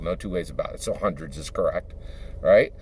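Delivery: normal talking voice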